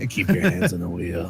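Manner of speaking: low creepy voice. Acted. Player repeating the pervert's line to friends, low creepy voice. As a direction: drop low and play the creep. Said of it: "That's your creepy voice".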